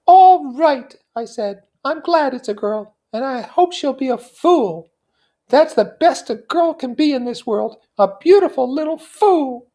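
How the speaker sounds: put-on high young woman voice; Daisy voice. A narrator's reading. Daisy quoting herself.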